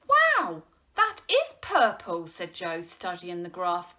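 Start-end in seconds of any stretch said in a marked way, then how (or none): 0.1-2.3 loud, high exclamation of wonder; high-pitched, impressed wow; amazed. A narrator's reading. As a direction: blurt it in astonishment. Boy amazed at the graph.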